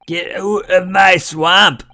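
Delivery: high slow hammed-up ogre shout; Shrek impression. Host spoofing Shrek, high and slow. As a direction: drag out the swamp line like Shrek.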